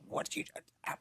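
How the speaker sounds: imitating a barely audible whispered question; hushed whisper. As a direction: whisper it under your breath in church. Sister-in-law questioning him during funeral.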